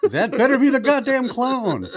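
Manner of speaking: high, laughing outrage; incredulous. Retold or imitated. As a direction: squeal it in disbelief. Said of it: "I was like".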